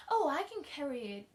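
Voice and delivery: cheerful reply with a lively lilt; cheerful child. A narrator's reading. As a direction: chirp it brightly and helpfully. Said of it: "the child responded cheerfully".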